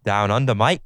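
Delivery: loud put-on Aussie accent; hammed-up Australian greeting. Co-host asks for Australian, loud Aussie accent.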